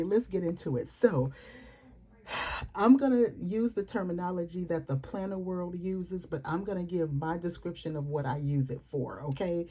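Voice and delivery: usual speaking voice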